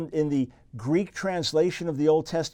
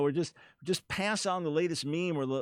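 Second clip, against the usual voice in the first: usual speaking voice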